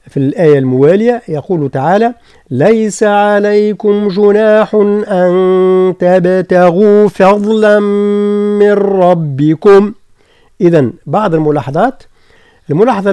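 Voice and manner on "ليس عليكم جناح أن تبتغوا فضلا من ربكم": slow, drawn-out tajweed chant; Quran recitation. Shown as a demonstration. Teacher recites the verse for students, slow chant. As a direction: recite it long and steady.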